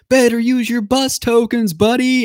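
high, slow taunting jab at a loser; mocking Roman. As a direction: Roman gloating at the losing racer, high and drawn out. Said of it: "Roman's like".

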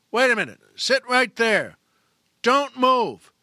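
loud, high, firm orders; nervous cop command. Acted. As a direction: bark at the driver to freeze. Nervous cop orders driver to freeze.

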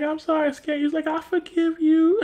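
put-on high childlike voice, slowing down; kid voice. Kids apologizing to Bernie in a high childlike voice. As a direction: say it like a sweet little kid.

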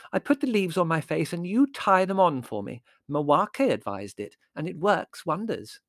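high, emphatic explanation; defensive youthful enthusiasm; earnest young daughter, insistent. Acted. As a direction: insist eagerly on the cure.

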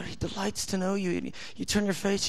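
usual speaking voice

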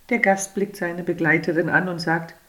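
ordinary delivery, nothing marked

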